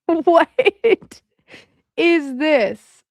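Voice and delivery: squealing, high, through laughter; laughing in disbelief. Real, not acted. Reacting to Whitney's chat post, laughing helplessly. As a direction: laugh so hard the words crack.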